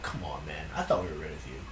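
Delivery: low, hushed grumble; dismayed mutter. Retold or imitated. Mom seeing the same dude return, dismayed mutter. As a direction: grumble it low under your breath. Mom's dismayed remark under her breath.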